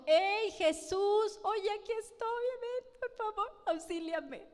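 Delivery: high, pleading mock call to Jesus; a hammed-up plea, pitched well up; pretend pleading. Voices a plea the woman never said.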